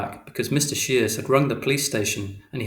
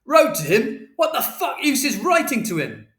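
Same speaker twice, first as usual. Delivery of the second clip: high, loud, incredulous yell; furious shout; 13 semitones higher, 7 dB louder than usual. A narrator's reading. Father scorning Mother's letters, yelling high. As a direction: shout back in disbelief. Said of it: "And Father shouted".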